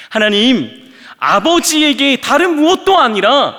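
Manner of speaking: rising to a loud, high demand; arguing with God. Acted. Preacher demands answers from God, building from calm to a loud challenge. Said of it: "저는 따질 겁니다".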